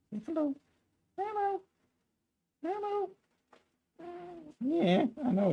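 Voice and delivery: very high, soft coaxing voice; cat talk. Real, not acted. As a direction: coax the cat to say hello, high and gentle. Coaxing cat to greet viewers.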